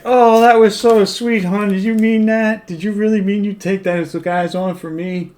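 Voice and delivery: put-on swooning biker's girl; gushing girlfriend. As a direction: coo it high like an impressed girlfriend.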